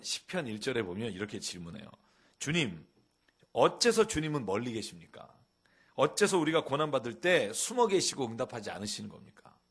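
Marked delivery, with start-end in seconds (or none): 2.4-9.6 impassioned psalm reading. Acted. Preacher reads lament psalm aloud.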